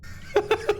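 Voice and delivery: breathy manic giggle; high crazed cackle; high unvoiced cackle. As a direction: giggle like a madman. Mad laugh in crazy voice.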